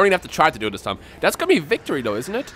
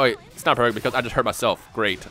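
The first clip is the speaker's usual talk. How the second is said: normal talking voice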